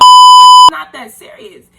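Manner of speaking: very high, loud and slow, half laughing; shrieking through laughter. Real, not acted. Telling viewers it's no big deal, high loud laughing squeal.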